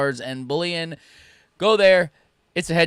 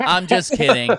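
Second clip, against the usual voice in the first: laughing; 10 semitones higher, 8 dB louder than usual. Real, not acted. Laughing off the joke.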